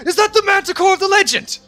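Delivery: high, loud, awestruck question; a bandit wonders if this is the Manticore, awed. Acted. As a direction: gasp it out like you've seen a myth. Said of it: "actually goes like".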